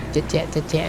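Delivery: quiet wet squishing noises; soft squelch imitation. Gamer imitating the hidden squid's soft squelching. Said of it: "เสียงมันแบบ".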